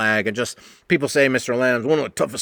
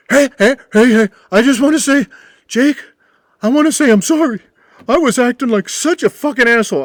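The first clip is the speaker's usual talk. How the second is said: much higher, loud, hammed-up drunk; stumbling drunk pleading sorry; drunk apology; 11 semitones higher, 7 dB louder than usual. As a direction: drunk regular begging forgiveness, high and loud. Drunk Butch apologizing, voiced.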